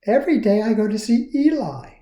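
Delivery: lightly voiced cheerful girl; Lucia voice. A narrator's reading. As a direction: make it sound simple and kind. Lucia speaks.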